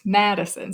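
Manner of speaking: "Madison" as MAD-i-son; stress on first syllable. Shown as a demonstration. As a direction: hit the first syllable of "Madison", rest light. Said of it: "we pronounce that one a little bit louder".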